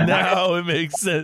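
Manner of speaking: slow, laughing through the words; laughing repeat. Real, not acted. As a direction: laugh it out as it sinks in.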